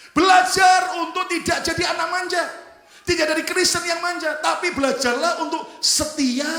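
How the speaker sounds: loud, high preacher's exhortation; full-force preaching. Real, not acted. Preacher urging the congregation not to be spoiled, full-force preaching. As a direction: drive it home to the congregation at a shout.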